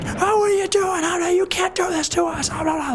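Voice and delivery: high, frantic mock wail; panicked crowd. Crowd begging the guards in high panic. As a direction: plead in a squeaky panicked pitch.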